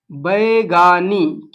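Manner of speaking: Baigani stretched out very slowly, each syllable drawn long; Baigani spoken slowly and clearly for class; slow drilled answer. Shown as a demonstration. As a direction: drag out Baigani so students catch it. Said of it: "क्या बोलते हैं बैगा लोग?".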